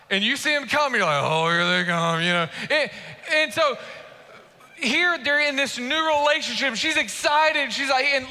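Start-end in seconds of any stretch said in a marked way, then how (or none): 1.2-2.5 low, unimpressed mock greeting of a spouse; long-married unenthusiasm. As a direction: act out a jaded spouse, pitch dropped. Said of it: "you've been married for a long time".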